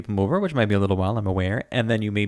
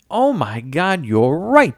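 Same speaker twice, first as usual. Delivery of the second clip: excited; 6 semitones higher, 6 dB louder, much slower than usual. Retold or imitated. Retelling own surprised reaction.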